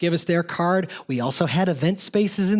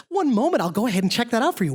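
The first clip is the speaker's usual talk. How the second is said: high, quick Midwestern customer-service voice; 5 semitones higher, faster than usual. Acted. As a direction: put on your best polite Midwestern phone voice. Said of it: "put on my best Midwestern voice".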